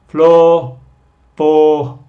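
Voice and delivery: "floor" said loud with a falling tune; loud model word. Shown as a demonstration. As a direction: call "floor" out to the class. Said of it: "es el mismo sonido".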